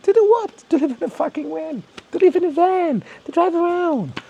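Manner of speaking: high-pitched exasperated rant; worked-up, emotional. Real, not acted. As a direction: let real bafflement push the voice way up. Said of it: "I'm always getting a little emotional".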